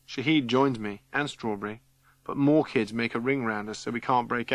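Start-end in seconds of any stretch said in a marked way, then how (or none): none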